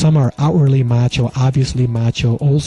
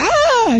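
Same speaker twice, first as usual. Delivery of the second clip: shocked shriek. Retold or imitated. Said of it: "she was like".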